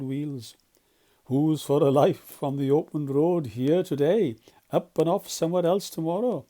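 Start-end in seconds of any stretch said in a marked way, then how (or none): 1.2-6.4 louder, bright showman's call; selling the open road with glee; gaily. Acted. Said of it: "said Toad gaily".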